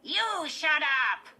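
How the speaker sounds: tight, high-pitched, slow dummy retort; Slappy. A narrator's reading. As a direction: squeak it back loudly like a puppet. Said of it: "replied in Lindy's tight, high-pitched voice".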